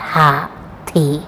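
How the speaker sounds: हाथी stretched out very slowly and quietly; slow and soft. Shown as a demonstration. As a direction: draw out हाथी gently for the class. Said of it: "इस प्रकार".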